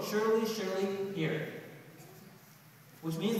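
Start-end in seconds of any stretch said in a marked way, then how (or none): 0.0-1.5 "surely" drawn out very slowly, repeated for weight; emphatic surely. Shown as a demonstration. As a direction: stretch out "surely, surely hear". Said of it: "two articles of emphasis".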